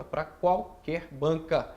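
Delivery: slowly, leaning on qualquer; slow emphatic repeat. Shown as a demonstration. Teacher repeating the answer slowly for the student. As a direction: draw out Pra qualquer banca word by word. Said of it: "Dixon, pra qualquer banca?".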